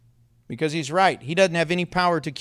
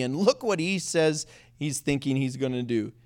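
ordinary talk

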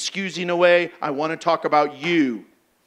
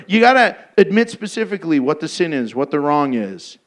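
ordinary talk, nothing marked

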